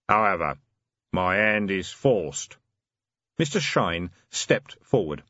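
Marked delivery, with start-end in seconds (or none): 0.1-2.6 slow, grave hooded stranger; deep voice. Acted. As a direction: speak like a looming shadow. Mister Shine speaking.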